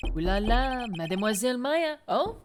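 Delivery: hammed-up French accent, loud and theatrical; mock-French flourish; terrible fake French accent. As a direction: gush like a cartoon Frenchman, accent laid on thick. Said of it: "my terrible fake French accent".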